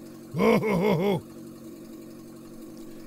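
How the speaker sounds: demonstrated throat gurgle; gurgly throat noise. Shown as a demonstration. Showing what allergies do to the throat, gurgly noise. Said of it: "My whole throat gets gurgly".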